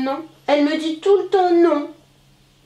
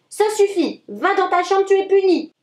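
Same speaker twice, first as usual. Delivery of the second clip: a fed-up dad laying down the law; angry father; 7 semitones higher than usual. A narrator's reading. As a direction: scold the child sharply. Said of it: "papa s'énerve et dit".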